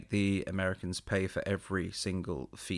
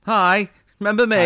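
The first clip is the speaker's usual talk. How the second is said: higher, louder, pouty hello; mock hurt hello; 13 semitones higher, 14 dB louder than usual. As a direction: ham up being forgotten. Cohost jokingly reminds he exists.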